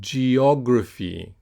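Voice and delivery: slow "Geography", both E and O clear; "Geography" with a long, stressed second vowel; repeat-after-me model. Shown as a demonstration. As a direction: stretch "Geography" as ge-OG-raphy. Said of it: "So repeat after me".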